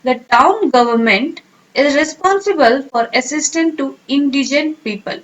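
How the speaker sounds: very slow, word by word; slow teacher dictation. Shown as a demonstration. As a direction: read it slowly for students to repeat. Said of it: "sentence क्या बोलेंगे".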